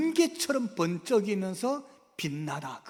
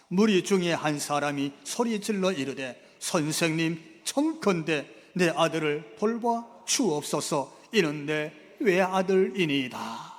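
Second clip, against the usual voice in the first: slow, louder congregational reading; scripture read aloud; 7 dB louder, slower than usual. Acted. Preacher leading the congregation through the verse slowly. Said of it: "본문 삼십팔 절 같이 읽어봅시다".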